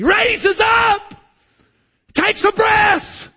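loud, slow, high gasping climax; preacher's loud slow swell; dramatic rise. Acted. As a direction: heave it out like a man fighting for air. Preacher acting out dying gasp.

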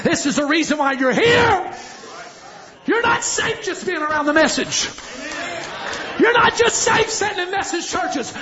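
high shouted preaching; full-force preaching. Acted. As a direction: cry out God's command with fire.

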